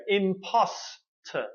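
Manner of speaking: imposter drawn out slowly; slow demo of imposter; slow model word. Shown as a demonstration. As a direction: say imposter slowly, each syllable clear. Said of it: "the three syllable word".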